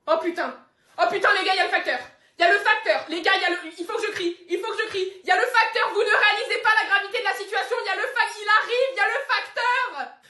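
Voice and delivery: high, loud, frantic yelling; frantic barking dog. Acted. Dog yelling to the family that the mailman is here, frantic. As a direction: panic as the mailman comes.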